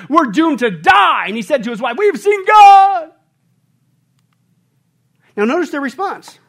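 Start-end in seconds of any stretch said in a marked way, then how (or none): 0.1-3.1 terrified shout. Acted. Manoah crying to his wife in terror. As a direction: cry out that you're doomed. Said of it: "what did he say".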